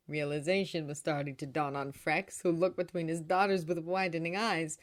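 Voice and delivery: ordinary delivery, nothing marked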